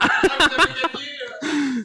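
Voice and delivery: loud cheerful announcement. Real, not acted. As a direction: announce the prize loudly. Jokey reveal to the victim.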